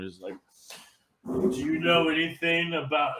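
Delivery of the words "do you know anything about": imitation ending in a big breathy exhale; breathy inspector impression. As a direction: get right up close and exhale the words. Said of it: "breathe his garlic breath on them".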